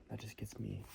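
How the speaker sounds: ordinary talk, nothing marked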